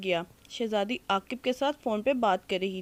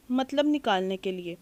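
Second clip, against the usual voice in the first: ordinary delivery, nothing marked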